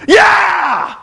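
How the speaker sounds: high, loud hammed-up whoop; Flair yell. Flair grabbing the mic to answer Hogan, hammed-up yell. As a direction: bellow like a brash old wrestler. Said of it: "Flair gets a mic and he goes".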